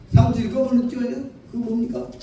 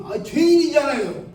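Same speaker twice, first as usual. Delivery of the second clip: put-on higher, slow insistent old lady's answer; stubborn elder's reply; 6 semitones higher than usual. Preacher voicing the elder's stubborn reply, slow and higher. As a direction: drag it out like she's stating the obvious.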